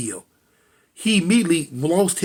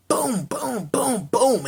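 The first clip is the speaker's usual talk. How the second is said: higher-pitched booms, evenly fired off; imitated gunshots; 6 semitones higher, much slower than usual. Storyteller voicing the shots that hit her, imitated gunfire. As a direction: mimic four shots with your voice.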